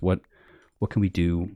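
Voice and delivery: ordinary delivery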